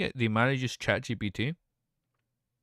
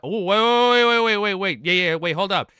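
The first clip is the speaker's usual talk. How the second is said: urgent wait; 7 semitones higher, 9 dB louder than usual. Real, not acted. Urgently stops chat spoilers.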